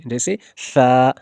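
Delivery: slow drawn-out सा with the tongue between the teeth; tongue-tip letter demo. Shown as a demonstration. Teacher to student, slowly drawn-out सा. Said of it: "जब आप इन हुर्फों को अदा करते होगे".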